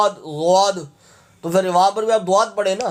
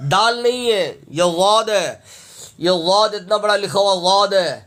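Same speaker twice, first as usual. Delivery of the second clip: normal talking voice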